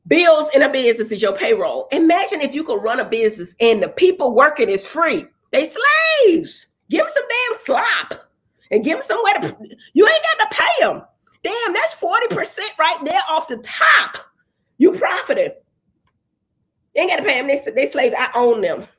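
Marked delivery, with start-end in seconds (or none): 5.5-13.6 a callous, gloating slave owner voiced much higher; mock slave owner. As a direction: sneer it like a master who pays nothing. Voicing a slave owner's greed.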